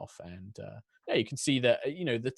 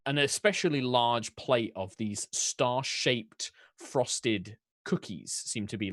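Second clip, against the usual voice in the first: ordinary delivery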